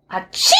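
a quick sneeze; sneezing. Acted. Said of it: "muss ich machen".

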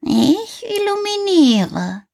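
high, slow cat voice; little cat. A narrator's reading. The cat answers Häwelmann from the tree, high and slow. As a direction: purr it out proudly like a little cat. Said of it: "rief die kleine Katze herunter".

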